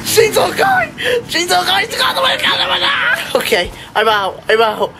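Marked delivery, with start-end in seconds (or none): 0.1-2.8 very high, tearful-sounding shriek; real panicked screaming. Real, not acted. Player trapped by chainsaw guy, screaming in panic. Chainsaw enemy attacks, blocking escape. 2.8-3.4 very high scream of terror; panicked scream. Real, not acted. Player caught by chainsaw guy, screaming.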